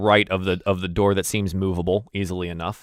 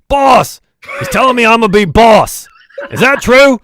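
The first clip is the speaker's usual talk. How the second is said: high, loud, eager yelling; dwarf guard hollering the news to his boss; excited shout to boss; 11 semitones higher, 11 dB louder than usual. Acted. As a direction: call back over your shoulder, hoping for a promotion.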